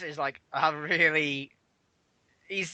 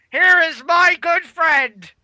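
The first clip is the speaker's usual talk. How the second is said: high, loud, very slow ring announcement; announcer Vince; 9 semitones higher, 6 dB louder, much slower than usual. Retold or imitated. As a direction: stretch out the big reveal like a showman. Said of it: "Vince comes out and goes".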